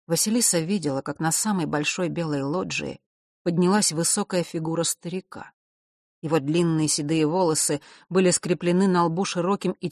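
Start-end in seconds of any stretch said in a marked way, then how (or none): none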